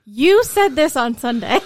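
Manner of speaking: loud, laughing jab in a spouse's voice; mock accusing spouse. Spouse quoting the pastor mid-fight, loud and laughing. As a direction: fling the pastor's own words back, laughing. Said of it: "it's even better when you say".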